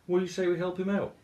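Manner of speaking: rally the others, upbeat and fast; Moose voice. Acted. Moose rallies the animals.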